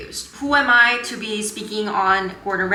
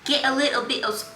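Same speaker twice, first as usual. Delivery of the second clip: put-on British accent; 6 semitones higher than usual. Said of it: "I'm working on my British accent".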